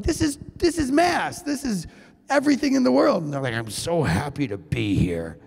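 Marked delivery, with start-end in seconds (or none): 0.0-3.2 mock gushing excitement. Speaker's excitement about Mass. 3.5-5.4 mock glum churchgoer.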